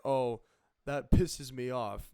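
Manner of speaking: loud, slow complaint; loud annoyed. Shown as a demonstration. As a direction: belt it out, fed up and slow. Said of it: "People will say".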